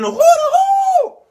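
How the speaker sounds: high, loud falsetto hooting; drawn-out rallying hoo-hoo; bullhorn whoop. Acted. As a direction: whoop like a siren through a bullhorn. Leader whoops through the bullhorn.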